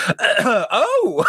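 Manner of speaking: high startled oh with a laugh; retold startled gasp. Retelling meeting a famous artist, high startled laughing oh. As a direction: blurt out a shocked laughing oh.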